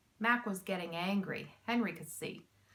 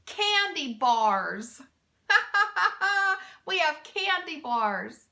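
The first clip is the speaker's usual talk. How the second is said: slow, louder, laugh bursting between words; 7 semitones higher, 6 dB louder, slower than usual. A narrator's reading. Mac reveals the cargo, laughing. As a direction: drag it out, cracking up. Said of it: "laughing so hard".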